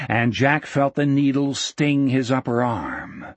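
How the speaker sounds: ordinary delivery, nothing marked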